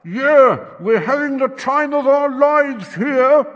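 ordinary delivery

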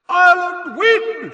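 very high, loud, drawn-out proclamation; announcer's shout. A narrator's reading. Commentator announcing the winner to the crowd, shouting. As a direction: shout the result to a whole stadium. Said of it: "shouted Bagman".

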